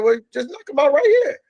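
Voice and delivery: high teasing voice. Retold or imitated. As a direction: dare him playfully, pitch up. Retold teasing order.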